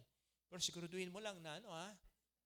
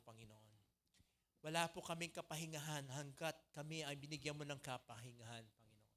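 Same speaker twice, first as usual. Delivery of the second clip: prayer. Real, not acted. Leads closing prayer. (mahinahong panalangin)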